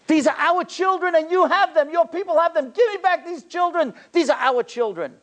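high-pitched, insistent outcry; impassioned demand. Retold or imitated. Rabbi demanding children back from Pope.

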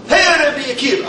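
cocky Roman greeting in a light mock Italian accent. Roman greets the rabbi, light mock Italian accent. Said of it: "I don't know if he had an Italian accent".

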